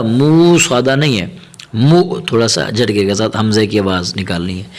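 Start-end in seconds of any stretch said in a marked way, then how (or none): none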